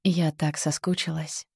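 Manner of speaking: intimate, airy breathy tone; breathy, like sharing a secret. Shown as a demonstration. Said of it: "В личных разговорах". (интимное придыхание)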